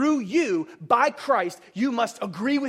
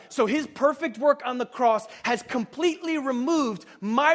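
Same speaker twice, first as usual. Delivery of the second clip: preaching; 3 semitones higher, 5 dB louder than usual. Acted. Preacher builds to full force.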